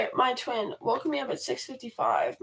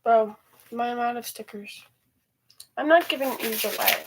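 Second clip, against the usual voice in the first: ordinary talk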